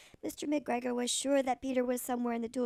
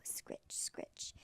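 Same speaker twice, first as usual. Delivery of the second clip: scratching noise; 14 dB quieter than usual. A narrator's reading. Sound of a hoe scraping.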